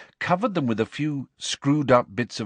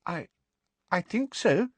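high, shaky small voice; unsteady and unsure; 10 semitones higher than usual. A narrator's reading. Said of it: "she said shakily".

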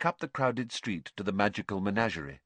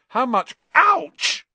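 pained yelp; 9 dB louder, slower than usual. Acted. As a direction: question cut off by a high, loud yelp. Interrupted by sudden pain.

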